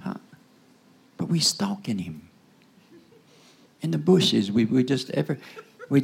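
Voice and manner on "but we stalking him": whispered aside. Acted. Hushed preaching aside.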